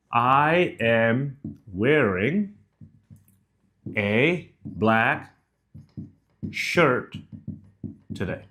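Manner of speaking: one word at a time with long gaps; drawn out, each word set apart by a pause; slow, word by word. Shown as a demonstration. Coach sets up an example sentence, slow word by word.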